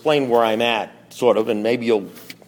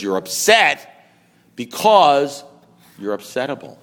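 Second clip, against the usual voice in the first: slow, with a pause before and after BECAUSE; deliberate verdict; slower than usual. Retold or imitated. Sponsor finally telling him why he's upset, deliberate. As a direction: build on UPSET and BECAUSE, then drop on upsettable. Sponsor delivers the punchline slowly.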